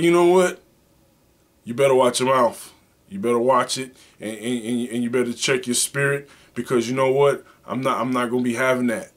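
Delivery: hammed-up stern husband warning his wife; mock warning. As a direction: threaten her to watch her mouth.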